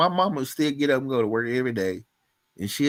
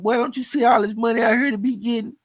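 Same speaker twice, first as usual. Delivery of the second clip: higher, brighter repeat of the boss's hype; the boss insisting, higher and more eager; cheerful boss; 9 semitones higher than usual. Retold or imitated. Mentor repeating his hype to a stunned worker, higher eager call. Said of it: "He said".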